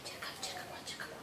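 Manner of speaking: whispered command to a dog; whispering. Real, not acted. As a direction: hush it to the dog, all breath. Quietly telling the dog to search.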